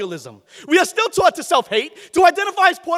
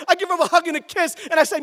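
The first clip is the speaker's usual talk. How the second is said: ordinary talk, nothing marked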